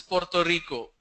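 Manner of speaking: Puerto Rico with crisp rolled r; South American pronunciation. Shown as a demonstration. Teacher showing how South America says Puerto Rico, crisp r. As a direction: sound both r's in Puerto Rico, "porto riko". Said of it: "In Southern America they pronounce this as".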